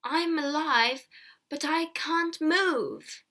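very slow. A narrator's reading. Stricken peddler to villagers, talking very slowly. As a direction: drag each word out with effort. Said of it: "began to talk very slowly".

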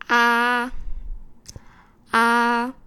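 loud 啊 with the mouth opened wide. Shown as a demonstration. Teacher showing a strong vowel, mouth wide open. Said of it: "例如".

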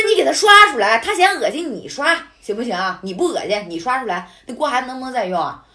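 fast angry scolding of the fussy landlady; retold indignant retort. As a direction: rattle it off in outrage. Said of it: "我说".

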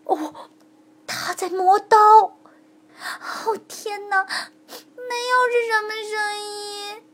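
scared of what is coming; frightened. A narrator's reading. Girl hears knife sharpened. (小珠子害怕地小声颤抖)

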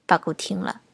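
ordinary delivery

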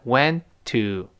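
"went", a brief hold, then "to"; held stop. Shown as a demonstration. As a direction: hold the t of "went" unreleased before "to". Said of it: "舌尖顶住停一下".

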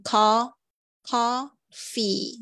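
"coffee" said very slowly with a long final EE; teacher slowly modelling "coffee"; slow model pronunciation. Shown as a demonstration. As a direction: stretch the EE at the end of "coffee". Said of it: "所以注意一下".

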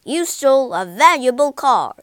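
loud, thundering charge; fierce accusation. A narrator's reading. The judge charges Toad in court, bellowing fiercely. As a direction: bellow out each offence sternly. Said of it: "said the judge fiercely".

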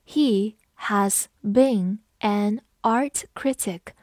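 He has been an art critic, slow, one word at a time; teacher's slow model with gaps between words; slow read-along. Shown as a demonstration. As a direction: read it slowly so learners can follow. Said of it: "跟着我慢读一遍".

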